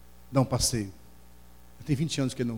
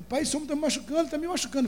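put-on crying little boy. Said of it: "aí ele".

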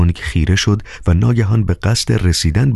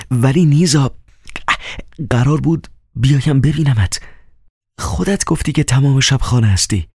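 put-on high, childlike begging voice; childlike pleading; 8 semitones higher than usual. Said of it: "با صدایی کودکانه به عجز". (با صدای کودکانه و ملتمس، زیر)

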